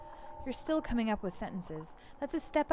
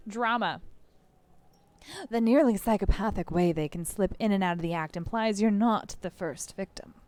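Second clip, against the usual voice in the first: ordinary talk, nothing marked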